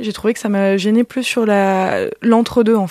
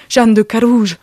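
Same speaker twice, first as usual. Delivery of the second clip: hammed-up theatrical imitation, louder; théâtral; 2 semitones higher, 5 dB louder, slower than usual. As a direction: proclaim "Jeanne de Carouge" like a stage actor. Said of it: "comme ça aussi, euh, théâtre". (imitation théâtrale et appuyée)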